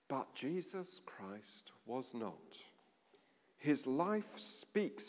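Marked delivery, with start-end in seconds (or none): 0.1-2.6 slow, hushed whisper for emphasis; a slow reverent whisper; dramatic whisper. Acted. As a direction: drop to a whisper to land the point. Dramatic contrast in sermon.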